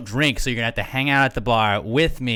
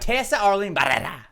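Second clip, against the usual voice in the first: "Tessa Arlene Barrera" rolled R while laughing, higher; laughing Spanish try; 7 semitones higher than usual. Shown as a demonstration. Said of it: "more Mexican".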